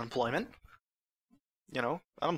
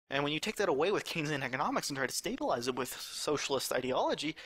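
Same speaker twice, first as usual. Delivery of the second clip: normal talking voice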